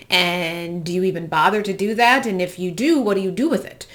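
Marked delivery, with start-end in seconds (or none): none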